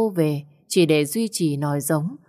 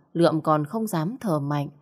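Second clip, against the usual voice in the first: ordinary delivery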